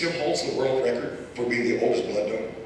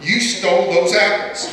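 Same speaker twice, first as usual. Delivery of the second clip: raised, louder accusing shout; retold yell; 5 semitones higher, 6 dB louder than usual. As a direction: yell it like an angry grocer. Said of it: "screaming at Phil".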